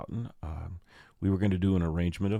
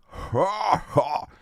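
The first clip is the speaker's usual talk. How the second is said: high, loud mock cackle; big hammed-up two-beat laugh; Darrell Hammond's Connery laugh; 11 semitones higher, 7 dB louder than usual. Taunting game show contestant, loud high mock laugh. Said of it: "Darrell Hammond laugh on Celebrity Jeopardy".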